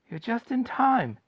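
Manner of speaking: put-on high, slow cry of an old grandfather; eager old man's call. Grandpa Joe telling the family, lifted excited call. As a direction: call it out with glee, stretched. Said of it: "shouted Grandpa Joe".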